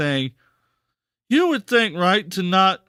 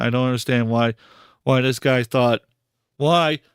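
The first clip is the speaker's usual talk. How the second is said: ordinary talk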